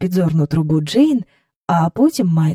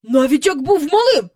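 high, loud indignant tirade; outraged official, pitched up and loud; shouting threats; 12 semitones higher, 5 dB louder than usual. A narrator's reading. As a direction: yell threats of complaint at the sky. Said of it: "викрикував свої погрози в небо".

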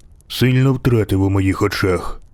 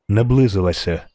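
plain reading voice

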